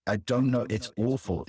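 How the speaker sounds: ordinary delivery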